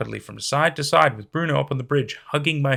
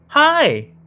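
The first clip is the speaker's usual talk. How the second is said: bubbly greeting; 13 semitones higher, 9 dB louder than usual. A narrator's reading. Eerie voicemail voice from bushes.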